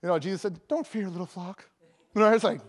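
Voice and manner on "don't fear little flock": soft, underplayed reading; unimpressed quote. Preacher repeats a verse that rubs him wrong, soft and unimpressed. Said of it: "that just rubs me the wrong way sometimes".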